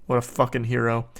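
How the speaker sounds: ordinary delivery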